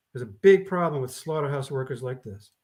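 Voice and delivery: usual speaking voice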